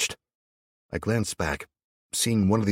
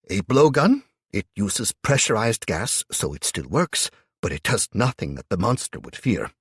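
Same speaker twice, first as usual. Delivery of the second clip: put-on old German man; 5 semitones higher, 7 dB louder than usual. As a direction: speak as a kindly old German. Marcus, old German, voiced.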